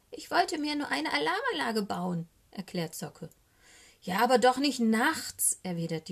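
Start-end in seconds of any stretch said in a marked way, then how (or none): 0.1-2.3 high, small raven voice; meek explanation from a scolded youngster; defensive little raven. A narrator's reading. Scolded raven explains himself to owl. 4.0-5.6 loud, high indignant owl; exasperated owl reprimand; scolding. A narrator's reading. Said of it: "Die Eule schimpft so lange".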